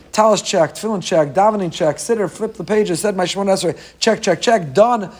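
brisk mock checklist. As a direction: rattle off obligations to be done with them. Acts ticking off prayer obligations.

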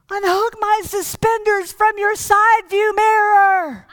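put-on high, slow old-man voice with a laugh breaking through; strained old man. Old man still hooked to the mirror gives the punchline, high and slow, laughing.

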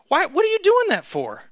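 lightly voiced mom, pitched high; a hint of a puzzled mother asking why; questioning mom. Mom questioning the neighbor boy, high and puzzled. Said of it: "And she's like".

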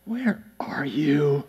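slow, whispered exasperated question; worn-out, breathy and very quiet; complete exasperation. Shown as a demonstration. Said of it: "Just complete exasperation".

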